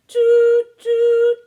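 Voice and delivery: high, loud train whistle toots; piping train whistle sounds. Pretend. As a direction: toot like a little train whistle. Train whistle in children's rhyme.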